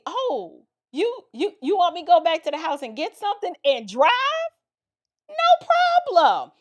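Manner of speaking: high, sing-song eagerness in a retold reply; eager feigned nonchalance. Teen aunt jumping at sister's errand, eagerly nonchalant. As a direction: jump at the chance, pitching it high. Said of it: "I use this as an opportunity".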